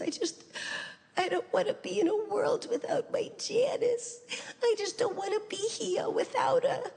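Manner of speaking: very high, gasping mock sob; imitated sobbing. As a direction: wail weakly like a father breaking down in tears. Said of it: "he started sobbing".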